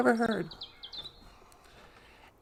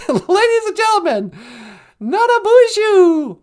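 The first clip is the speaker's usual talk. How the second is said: show announcer; 6 semitones higher, 6 dB louder than usual. Pretend. Host greeting the audience on the holodeck, announcer style. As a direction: announce it with a big flourish. Hammed-up stage introduction.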